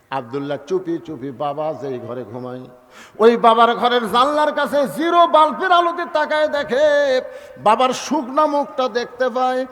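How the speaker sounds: ordinary talk, nothing marked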